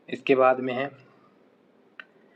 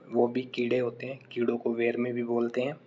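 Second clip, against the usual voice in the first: ordinary talk, nothing marked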